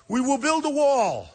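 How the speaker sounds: high, loud, drawn-out mock pledge; mocking politicians. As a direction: parody a politician's grand promise, slowly and loudly. Mocking copycat politicians' sudden pledge.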